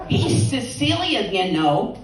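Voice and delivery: ordinary talk, nothing marked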